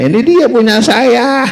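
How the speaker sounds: high, slow and glad; grateful woodcutter. Acted. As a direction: receive the old axe with relief. Woodcutter takes own axe.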